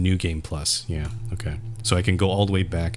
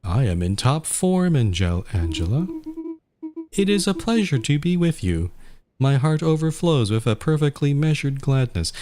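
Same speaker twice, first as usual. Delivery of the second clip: lightly voiced, raised pitch; character voices; 9 semitones higher than usual. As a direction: read the lines in a lifted character voice. Reads game dialogue in character voices.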